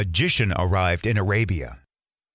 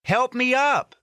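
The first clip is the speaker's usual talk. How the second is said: put-on high calling boy voice; Aladdin; 14 semitones higher than usual. Aladdin calling to the magician, high call. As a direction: call up from the cave. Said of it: "Aladdin said".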